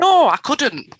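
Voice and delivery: high, loud mock-horrified protest; retold panicky no; alarmed refusal. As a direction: blurt out a comic, high-pitched refusal. Acting out her alarmed refusal.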